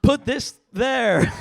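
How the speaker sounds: high, drawn-out American dirty talk; American porn impression. Comic demonstrating porn talk, mock American accent. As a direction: ham up an American porn star, loud and slow. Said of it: "I'm gonna have to do the impression".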